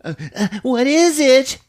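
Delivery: high, loud, stammering question; hammed-up panicky voice; frightened student. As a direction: stammer it out in mock fear. Spooked student in storm.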